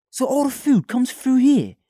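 high, loud posh-sounding British voice; imitating a British actor's tour guide voice; British accent. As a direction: mimic a Brit giving a tour. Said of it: "This was British?".

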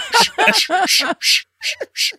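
urgent repeated shh, rising high; shushing. Retold or imitated. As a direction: hush someone frantically. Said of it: "holding his hand up and shushing me".

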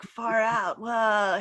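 a hint of a laid-back hippie; lightly voiced hippie catchphrase, tossed off. Critic mimicking an actor's punchline hippie accent, lightly voiced hippie. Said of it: "hippie kind of thing".

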